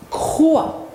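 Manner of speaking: "croix" said alone, high and drawn out; model syllable. Shown as a demonstration. Teacher repeats for pupils, "croix" isolated and carefully drawn out. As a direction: sound out "croix" as one deliberate syllable. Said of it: "Je répète".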